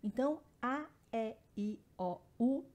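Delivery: each vowel sounded out clearly and separately; teacher reading A, E, I, O, U one by one; vowels spelled out. Shown as a demonstration. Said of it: "as vogais".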